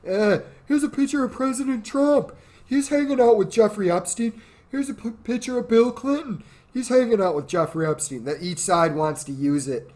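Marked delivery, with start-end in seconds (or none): 0.1-8.2 higher, mock newscaster presenting photos; lightly mocking higher voice; mocking pundit voice. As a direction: parody a smug partisan showing pictures. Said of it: "people conveniently ignore it, both sides".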